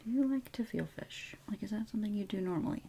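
very soft near-whisper; hushed roleplay voice. Pretend. Questioning the viewer about fish, hushed near-whisper. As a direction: ask it barely above a whisper.

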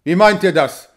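taken aback by the bluntness; shocked question, pushed out loud. A narrator's reading. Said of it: "ganz betroffen über diese Offenheit".

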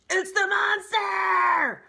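piercing high screech, slow and loud; owl screech. A narrator's reading.

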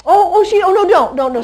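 loud, high oh no don't; urgent call. Real, not acted. Trainer halting a dog near the puppy: loud high call. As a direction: call out fast to stop the dog.

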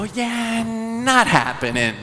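high, slow dismissive no; hammed-up rejection; mock brush-off. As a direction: turn down the flirt coolly. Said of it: "they go".

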